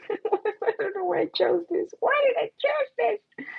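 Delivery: high laughing disbelief; high squeaky giggle. Real, not acted. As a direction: laugh at yourself. Laughing at own choice.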